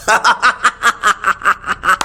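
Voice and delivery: long run of mocking ha-ha; drawn-out taunting laughter; teasing laugh. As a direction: laugh at your own jibe. Laughs at own teasing insult.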